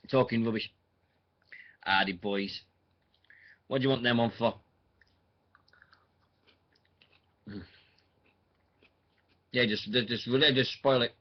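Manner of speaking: talking with mouth full of chicken; eating chicken. Real, not acted. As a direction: chew while you talk. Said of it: "Sorry, I'm just eating chicken".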